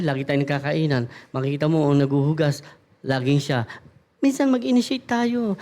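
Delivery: usual speaking voice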